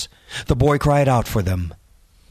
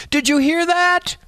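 frightened holler. A narrator's reading. First boy hearing the voice, high frightened call. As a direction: call out over the howling wind. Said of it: "hollered over the cry of the wind".

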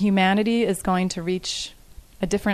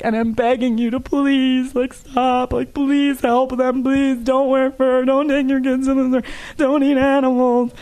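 higher, slow, tearful begging; tearful pleading; 6 semitones higher, 4 dB louder than usual. Retold or imitated. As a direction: plead through leftover sobs, slowly. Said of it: "I was saying all this sobbing afterwards".

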